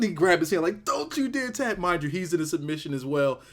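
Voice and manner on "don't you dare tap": high-pitched acted begging; hammed-up high plea; mock desperate plea. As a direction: imitate a wrestler urging his partner to hold on. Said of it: "He's like".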